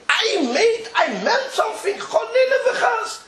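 high, pleading, laughing; horrified it was misunderstood; apologetic protest. Retold or imitated.

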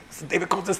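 usual speaking voice

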